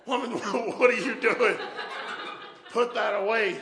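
high, loud, stammered alarm; startled scolding. Retold or imitated. Husband to wife rushing in with gun, startled scolding. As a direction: blurt it out in comic panic.

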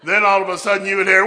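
loud, high preacher's fervour; fired-up revival preaching; preaching at full force. Acted. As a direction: build the sermon to a roaring climax.

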